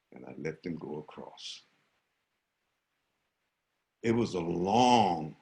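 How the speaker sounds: ordinary talk, nothing marked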